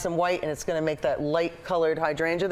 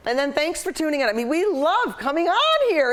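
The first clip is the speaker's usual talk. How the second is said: excited sign-off; 11 semitones higher, 5 dB louder than usual. Real, not acted. Signing off the show to viewers.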